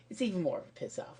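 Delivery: ordinary talk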